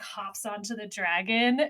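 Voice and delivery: normal talking voice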